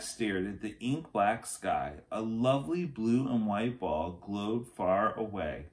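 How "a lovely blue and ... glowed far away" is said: ordinary delivery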